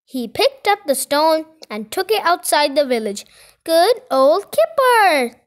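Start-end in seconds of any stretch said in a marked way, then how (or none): 3.6-5.4 loud, high, slow cheer; calling out. A narrator's reading. Villagers praise their helper, calling out. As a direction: call it out in praise, drawn out. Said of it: "everyone called".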